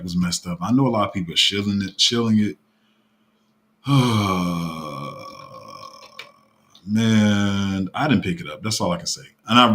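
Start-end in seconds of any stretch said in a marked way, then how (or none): 3.8-5.9 heavy, drawn-out sigh; long sigh. Real, not acted. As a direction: let out a long reluctant breath. Reluctant before admitting about the contract.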